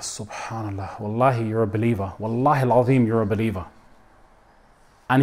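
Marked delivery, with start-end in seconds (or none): 0.0-3.6 soft reassurance. Retold or imitated. Imam comforting a crying young man.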